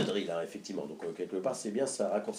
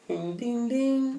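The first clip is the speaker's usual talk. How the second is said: station chime; 9 semitones higher, much slower than usual. Acted. Imitates station chime before announcement.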